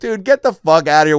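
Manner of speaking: dismissive yell. Retold or imitated. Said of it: "We're like".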